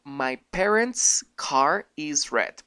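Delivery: very slow, careful English sentence; slow English demo. Shown as a demonstration. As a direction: spell it out slowly for learners. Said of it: "En realidad se dice".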